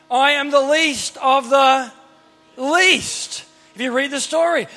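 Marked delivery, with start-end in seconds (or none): 0.1-3.4 slow, raised, with a pause before the last word; emphatic acted declaration. Gideon pleading he is nobody, slow emphatic mock declaration. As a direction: spell it out slowly, land on the final word.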